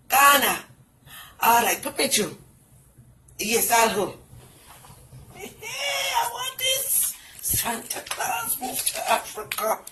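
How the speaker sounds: ordinary talk, nothing marked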